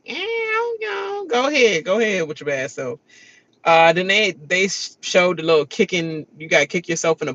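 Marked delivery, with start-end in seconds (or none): 0.0-3.0 mocking the houseguest.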